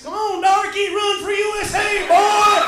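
loud, high shout urging the runner on; mock crowd yell. Fan urging on a US Olympic runner, mock shouting. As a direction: bellow it at a runner from the stands.

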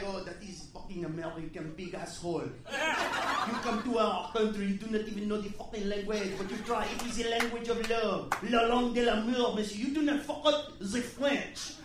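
hammed-up French accent, on a high horse; haughty French accent. Waiter scolding laughing Americans about language, haughty French accent. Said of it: "that fucking little high horse".

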